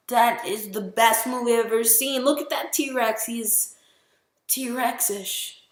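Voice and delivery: put-on high, excited kid voice gushing over the T-Rex. Boy recalls seeing the T-Rex, high excited gush. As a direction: squeal it like a thrilled kid. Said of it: "I was just like".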